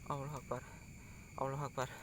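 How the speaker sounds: hushed, breathy muttered prayer; whispered prayer. Real, not acted. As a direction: murmur it under your breath, scared. Frightened prayer after fleeing.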